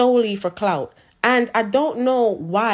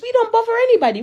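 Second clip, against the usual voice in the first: high, fast heated protest; 11 semitones higher, 4 dB louder, much faster than usual. Real, not acted. Protesting to the critics that Jamaicans bother nobody, high and fast.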